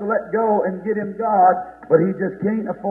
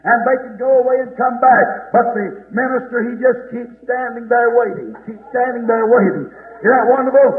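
raised, insistent preaching voice; fervent preaching; 5 semitones higher, 5 dB louder than usual. Real, not acted. Preacher urging the church to be bold, fervent preaching. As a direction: preach it out with conviction, hammering key words.

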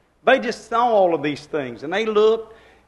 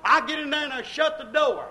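ordinary talk, nothing marked

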